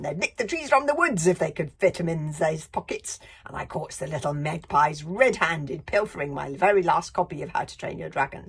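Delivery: loud indignant screech. Acted. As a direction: screech out the grievance. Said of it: "screeched the librarian".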